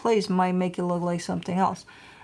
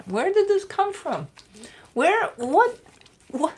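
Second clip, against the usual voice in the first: high playful sing-song; 9 semitones higher than usual. Real, not acted. Asking the playful dog where that came from, high baby talk. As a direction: tease the puppy with a high coo.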